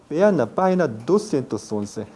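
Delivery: ordinary delivery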